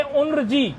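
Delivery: उन्रजी as un-r-ji; n before r. Shown as a demonstration. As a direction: put the n ahead of the r in उन्रजी. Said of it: "पहले नकार का उच्चारण करना चाहिए". (उन्रजी में पहले नकार, फिर रेफ)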